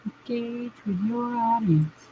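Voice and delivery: slow, soft put-on voice; lightly voiced mock advisor; mock advice voice. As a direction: slowly mimic a preachy coach.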